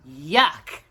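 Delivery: ordinary delivery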